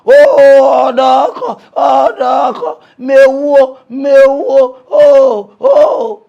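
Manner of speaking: loud crying wail of the mother; loud retold cry for help; mock wailing holler. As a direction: wail her son's name loud. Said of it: "Him mama dey holla".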